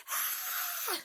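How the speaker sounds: high shrill mock scream of a startled wife. Pastor voicing a wife's startled scream at a bug. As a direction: squeal like a wife who just saw a cockroach. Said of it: "you hear the wife go".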